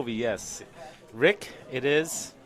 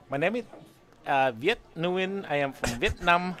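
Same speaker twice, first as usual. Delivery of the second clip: higher-pitched mock Vietnamese accent; 4 semitones higher than usual. Shown as a demonstration. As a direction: introduce yourself in a put-on Vietnamese accent. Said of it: "much of a Vietnamese accent, other than saying".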